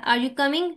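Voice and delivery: coming lifted at the end; rising question tune. Shown as a demonstration. Teacher repeating the example for students, rising on coming. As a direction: ask it with a rise on coming. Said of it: "rising tone है".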